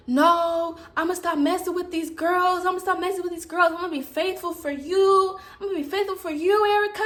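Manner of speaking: retold pleading, voice pushed up; lightly voiced pleading boyfriend. Boyfriend's pleading promises acted out.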